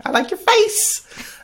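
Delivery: slow, squeaky teasing echo; high mock echo. Echoes partner in high voice.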